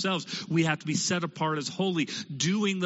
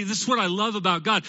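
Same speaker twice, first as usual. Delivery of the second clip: normal talking voice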